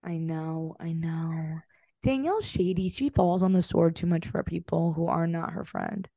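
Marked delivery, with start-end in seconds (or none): 0.0-1.6 whispering. Real, not acted. Whispered agreement.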